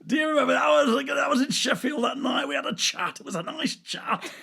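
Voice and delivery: very high, loud pestering fan voice; hammed-up fan shouting to be remembered; overeager fan. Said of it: "I went up to him and said".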